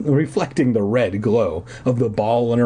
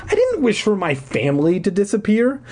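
screamed; 10 semitones higher than usual. A narrator's reading. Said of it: "I screamed".

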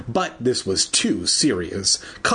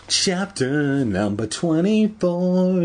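slow, drawn-out, raised announcer delivery; mock chapter announcement; 6 semitones higher, slower than usual. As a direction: announce it with overblown ceremony. Chapter heading mock-announced, then deflated.

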